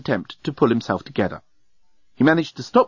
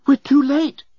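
high, dismayed announcement; urgent announcement; 4 dB louder, slower than usual. A narrator's reading. Nettie at the cell door announcing lateness, dismayed. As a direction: announce bad news in a raised pitch. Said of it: "she announced".

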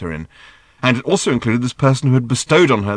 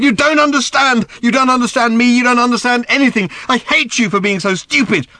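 put-on high, loud teenage rage; furious shouting; 6 dB louder than usual. A narrator's reading. Random screaming at Arthur, high and furious. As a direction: scream your hatred at him.

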